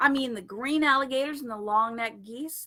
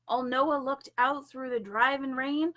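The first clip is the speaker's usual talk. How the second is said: plain reading voice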